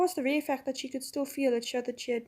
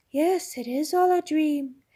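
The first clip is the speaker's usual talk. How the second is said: slow, lulling reassurance; the witch enchanting Jill; hypnotic witch; 4 semitones higher, 6 dB louder, slower than usual. A narrator's reading. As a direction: lull her into agreeing.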